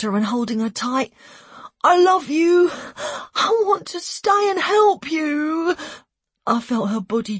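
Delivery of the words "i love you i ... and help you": high, drawn-out desperate plea; tearful pleading. A narrator's reading. Son holding his mum tight, tearfully pleading. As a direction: plead higher, slowly, on the edge of tears.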